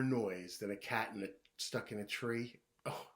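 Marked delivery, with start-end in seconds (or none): none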